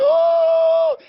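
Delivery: long, loud, high "oh" of swooning ladies; imitated crowd shout. Host mimicking the ladies shouting for the DJ, imitated crowd shout. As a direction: holler it like an excited audience. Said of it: "The ladies are shouting".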